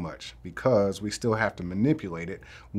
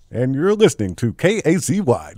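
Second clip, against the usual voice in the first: a touch of radio DJ, a bit louder; radio host voice; 6 dB louder than usual. Pretend. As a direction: announce the station like a DJ. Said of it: "I sound like a radio host right now".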